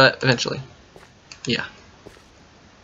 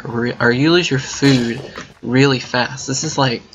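ordinary delivery

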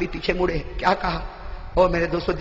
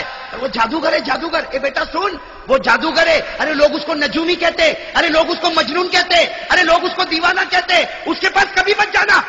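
rising urgent warning; 9 semitones higher than usual. Acted. Old woman warns against Muhammad.

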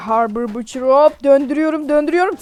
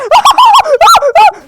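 high loud cackle; high shrieking laugh; 11 dB louder than usual. Real, not acted. Laughing at friend.

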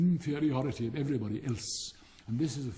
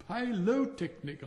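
high, slow, severe "pylotechnic"; 8 semitones higher, slower than usual. A narrator's reading. Rocket insisting on his own word, severe. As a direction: insist sternly on the L, pitched up. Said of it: "in a severe tone of voice".

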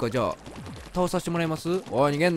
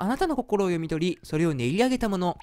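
ordinary talk

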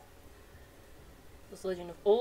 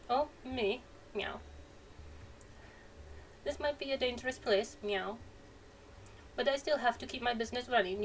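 higher, sly cat shopkeeper tacking on meows; purring merchant cat, a touch higher; 3 semitones higher than usual. Acted. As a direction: play a chatty cat merchant who meows between lines. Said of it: "Thought he was going meow again".

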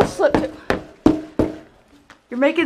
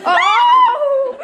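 high shout through a laugh; 10 semitones higher, 4 dB louder than usual. Real, not acted. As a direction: yell while laughing. Reacts with a laugh.

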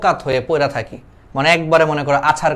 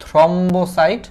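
slow teaching; 3 semitones higher, slower than usual. Shown as a demonstration. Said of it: "এটা ইংলিশে লিখে দেই".

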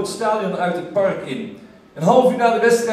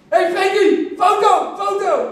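calling for photo; 10 semitones higher, slower than usual. Retold or imitated. Fan shouting at Rijkaard for a photo, high eager yell.

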